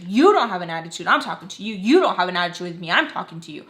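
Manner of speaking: imitated scolding, loud punches on YOU; mad, scolding. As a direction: tell her off, hitting each "you don't" hard. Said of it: "so she gets mad".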